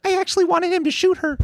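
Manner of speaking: very high, fast squeaky mimicry; tiny high-pitched imitation voice; mimicking a woman's blurt. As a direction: squeak it out quickly in mock confession. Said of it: "She's like".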